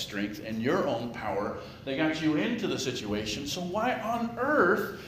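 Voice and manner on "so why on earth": emphatic. Real, not acted. Preacher's rhetorical outburst.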